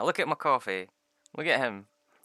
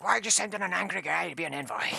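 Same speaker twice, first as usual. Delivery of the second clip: high, loud indignant protest; hammed-up outraged complaint; pissed-off envoy; 9 semitones higher, 5 dB louder than usual. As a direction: play an angry barbarian objecting to his posting. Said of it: "He's so pissed off at being an envoy".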